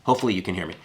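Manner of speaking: ordinary talk, nothing marked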